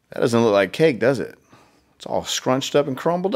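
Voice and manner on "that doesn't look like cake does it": plain reading voice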